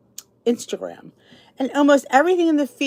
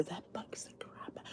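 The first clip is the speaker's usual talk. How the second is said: whisper; 4 semitones lower, 25 dB quieter than usual. Real, not acted. Whispered complaint.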